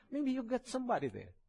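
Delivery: high, laughing mock-suspicious wife; teasing suspicious wife. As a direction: tease him like a jealous wife, laughing. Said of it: "my wife was complaining and said".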